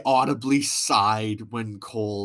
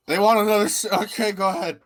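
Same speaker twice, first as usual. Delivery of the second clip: higher, louder, lazy shrug; mock Adam Pearce; 9 semitones higher, 6 dB louder, faster than usual. Imitating Adam Pearce drunkenly approving the match, mock careless. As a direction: wave it through like a careless boss. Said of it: "He, he's like".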